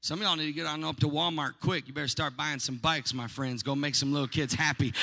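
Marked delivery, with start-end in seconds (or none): none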